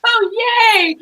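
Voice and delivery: mock-delighted high-pitched cheer. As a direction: retell it as a gleeful little squeal. Retold delight at a compliment.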